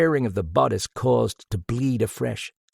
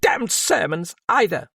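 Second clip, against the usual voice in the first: much higher, tearful, cried out against sobs; fighting sobs; 11 semitones higher, 5 dB louder than usual. A narrator's reading. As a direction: hold back the sobs while crying out. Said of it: "fighting those sobs".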